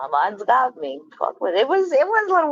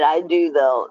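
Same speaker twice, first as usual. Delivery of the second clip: mocking quote; 6 semitones lower than usual. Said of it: "you just said".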